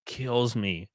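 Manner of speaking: ordinary talk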